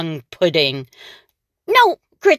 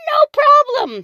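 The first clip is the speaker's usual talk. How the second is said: high, louder witch brushing it off; 5 dB louder, faster than usual. Acted. The witch checking her pantry, high and breezy. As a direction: toss it off like a cocky witch. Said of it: "said Gritch".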